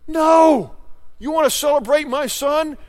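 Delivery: high, loud, incredulous outburst; God thundering back in disbelief; God's outraged reply. Acted. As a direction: boom it out as God refusing the excuse. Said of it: "And God says".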